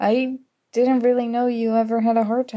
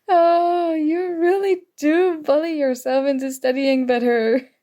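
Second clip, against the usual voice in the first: laughing surprise; 6 semitones higher than usual. Acted. Surprised by friend's notes.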